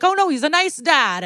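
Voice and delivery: high-pitched mock-naive self-quote; naive gushing. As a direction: gush it high, as if fooled. Mocking own earlier naive reaction.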